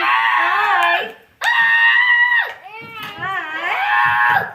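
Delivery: very slow, loud, high hi; loud drawn-out greeting; long loud hi. Real, not acted. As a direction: stretch out a big hi.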